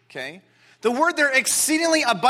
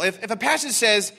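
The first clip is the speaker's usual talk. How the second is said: ordinary talk, nothing marked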